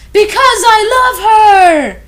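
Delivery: high, loud, slow declaration; hammed-up love shout; mock passionate confession. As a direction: belt out the love. Hagrid's dramatic confession.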